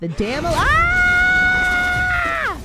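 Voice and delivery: fast rising shout that breaks into a high scream; reliving the jump scare in a full shout; retold scream. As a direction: yell it out and let it crack into a shriek. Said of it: "I said".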